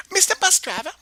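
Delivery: high, loud eager call; excited call. Acted. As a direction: grab his attention. Child calls to driver.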